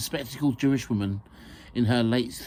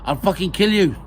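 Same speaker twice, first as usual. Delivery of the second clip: high, loud comic-angry threat; 9 semitones higher, 8 dB louder than usual. Acted. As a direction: threaten him, only half comically. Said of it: "with a touch less comedy".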